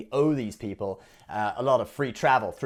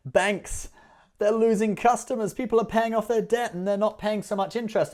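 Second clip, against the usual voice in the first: higher, hammed-up pity for the banks; mock sob story; 9 semitones higher, 4 dB louder than usual. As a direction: fake sorrow, laying it on thick. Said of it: "the whole sob story".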